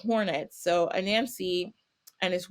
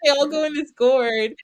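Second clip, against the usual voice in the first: high, loud laughing words; real laugh over the words; 8 semitones higher, 7 dB louder than usual. Real, not acted. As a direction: burst out laughing mid-sentence. Laughs while telling the gourd trick.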